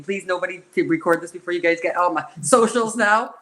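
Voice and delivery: ordinary talk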